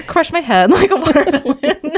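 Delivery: high-pitched, breaking into laughter; helplessly amused, high voice; laughing mock quote. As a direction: squeal it out through giggles. Joking mock quote through laughter.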